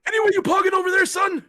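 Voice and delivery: put-on old man voice.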